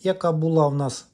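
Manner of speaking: ordinary talk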